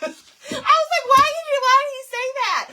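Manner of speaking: high, loud, laughing disbelief. Real, not acted. Mom cracking up at the husband's offer. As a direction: squeal it out through laughter.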